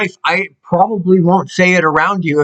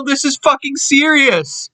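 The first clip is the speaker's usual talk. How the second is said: real emotional outburst; 7 semitones higher than usual. Real, not acted. Ranting to viewers, unapologetically emotional, pitched up. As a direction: pitch up, voice rising with real feeling. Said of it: "I'm not sorry for getting emotional".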